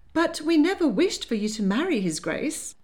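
put-on a flustered older lady clutching her pearls; fluttery Lady Hartwood. A narrator's reading. Lady Hartwood scolding her granddaughter, high and fluttery.

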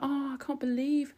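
very high-pitched, exaggerated outraged disbelief; mock complaining. Commentator imitating government complaining that workers went on strike, hammed-up disbelief. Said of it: "they'll complain and they'll be like".

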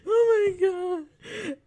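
high, loud, slow, laughing too hard; real laughter, barely breathing; breathless laughter. Real, not acted. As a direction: gasp it out mid laughing fit. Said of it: "Breathe, [name], breathe".

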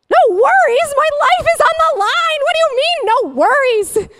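shrieking mock outrage, very high and loud; hammed-up indignant screech; outraged disbelief. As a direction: throw the words back in hysterical disbelief. Acting out outrage at his reply.

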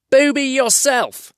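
high slow troll jeer. Acted. Tom trades insults with William.

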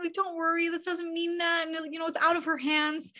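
high-pitched, gentle consoling; soothing reassurance. Retold or imitated. Friends calming a young man after his panic attack, soothing reassurance. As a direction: pitch up and keep reassuring, imitating a comforting friend.

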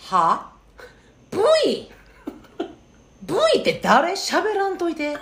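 imitating a scornful child, high and drawn out; mock scorn. Child correcting her mother's fandom slip, mimicked high, slow scorn. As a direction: slowly hammer home mock disbelief.

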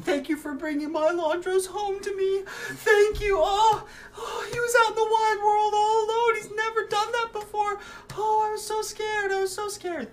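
very high, loud, weepy mother's voice; a sobbing mother pouring out her fear; crying mother. Acted. Mother thanks friends for bringing her son home, crying high. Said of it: "she is still crying and is saying".

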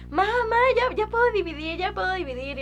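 put-on a kid bursting to show off; excited. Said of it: "yo bien emocionada le dije". (niña emocionada, voz más aguda)

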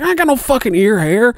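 high, exasperated disbelief; incredulous outburst. Retold or imitated. Young worker answering the boss about ear hair, high and incredulous. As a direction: squeak it out in disbelief. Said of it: "I was like".

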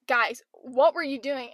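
ordinary talk, nothing marked

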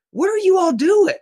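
high, incredulous question; stunned disbelief. Retold or imitated. Homeowner facing an officer's drawn gun, stunned high disbelief. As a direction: caught off guard, voice jumping up in shock. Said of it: "I'm so stunned".

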